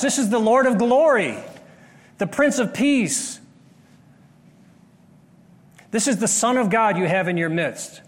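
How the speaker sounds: high, loud, building proclamation; preaching at full force; fervent preaching. Acted. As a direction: thunder it at the disciples. Preacher confronting disciples, rising.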